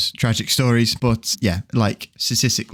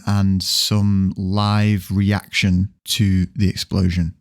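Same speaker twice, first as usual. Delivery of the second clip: ordinary talk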